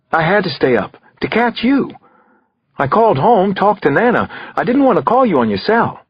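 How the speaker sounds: put-on eager boy explaining himself to his dad; fourteen-year-old son. A narrator's reading. Son phoning his detective dad late, teenage boy voice. Narrator voices Damon on phone.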